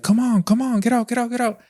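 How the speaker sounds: high, urgent yelling to chase it off; trying to sound big and tough, shouting it away; retold scream. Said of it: "I'm just screaming at her".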